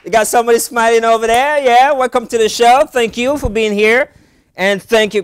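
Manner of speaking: loud, high welcome over applause; show-opening shout. Real, not acted. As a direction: call out to a cheering crowd. Host greets applauding studio audience.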